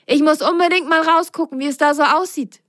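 impatient. A narrator's reading. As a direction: rush the words out, itching to look. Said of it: "wurde Ben ungeduldig".

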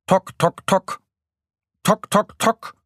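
high, staccato knocking imitation; sharp high woodpecker taps; loud woodpecker hammering. A narrator's reading. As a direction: knock out each syllable like a beak on wood. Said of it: "hämmert er lautstark".

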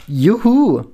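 high, loud whoop; loud cheer. A narrator's reading. Penelope celebrating that alcohol is fun, loud cheer. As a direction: whoop out in tipsy delight. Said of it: "ruft Penelope laut".

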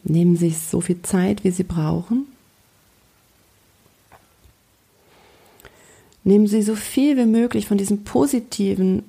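guided meditation. Acted. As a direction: gently lead a daydream. Guides a calm visualization. (ruhige Fantasiereise-Stimme)

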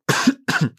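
sharp cough after swallowing wrong; throat clearing. Real, not acted. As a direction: clear the throat hard, choking a bit. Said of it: "ich hab mich verschluckt".

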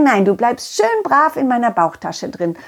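plain reading voice

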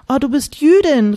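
high, loud delighted cry; excited exclamation. Acted. Beate exclaiming to Hanna, high and excited. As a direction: burst out thrilled at the news. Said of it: "rief Beate".

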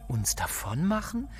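stunned question, rising at the end; shocked echo. Acted. Etty to Jack, hearing they must flee, stunned question. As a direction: echo his words in disbelief.

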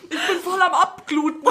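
hoch, mit Lachen gespielt; gespielter Schmerz. Pretend. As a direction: lachend jammern über den Finger. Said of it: "man hat sich im Finger gestimmt". (gespieltes Jammern mit Lachen)

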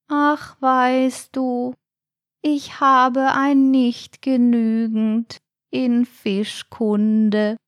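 slow, high, dejected snail voice; sad snail. A narrator's reading. Snail admits its failing grade, downcast. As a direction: confess it slowly and sadly. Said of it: "sah ganz traurig aus".

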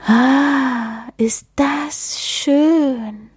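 slow, breathy sigh of pleasure; contented sigh. Acted. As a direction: melt into it, slowly. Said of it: "seufzt der Schneemann zufrieden".

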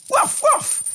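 very high yappy woof; squeaky high dog bark. A narrator's reading. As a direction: yap like a little dog. Dog barks in the tale.

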